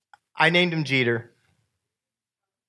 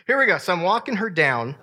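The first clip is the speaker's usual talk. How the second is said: ordinary talk, nothing marked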